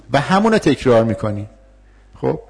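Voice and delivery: normal talking voice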